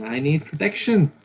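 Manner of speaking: high, loud, hammed-up frightened fish voice; frightened little fish. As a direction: plead like a tiny fish afraid of sharks. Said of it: "The Lord here is saying".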